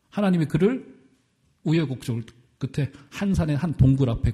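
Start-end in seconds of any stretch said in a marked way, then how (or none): none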